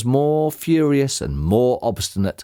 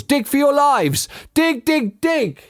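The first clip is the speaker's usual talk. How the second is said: high-pitched frantic urging; urgent shout; 13 semitones higher than usual. A narrator's reading. Mister Fox urges family to flee the shovels, shouting. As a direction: drive them on in a high, punchy shout. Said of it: "shouted Mister Fox".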